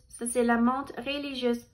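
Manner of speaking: ordinary delivery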